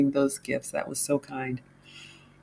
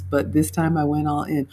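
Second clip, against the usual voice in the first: usual speaking voice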